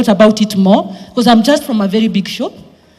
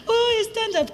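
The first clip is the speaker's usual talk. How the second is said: mocking; 12 semitones higher, 10 dB quieter, slower than usual. Directors mocking her stand-up plan.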